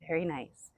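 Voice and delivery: soft sigh, then quiet praise. Shown as a demonstration. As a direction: breathe out, then softly praise. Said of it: "out through mouth with a sigh".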